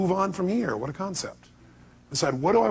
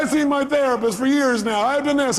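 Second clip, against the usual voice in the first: imitating caller; 9 semitones higher, 3 dB louder than usual. Caller listing her efforts.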